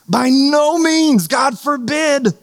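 high, drawn-out proclamation; emphatic refusal. Acted. Preacher voicing Luther refusing damnation, emphatic and drawn out. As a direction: stretch each word out high, in adamant denial.

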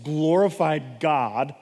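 ordinary talk

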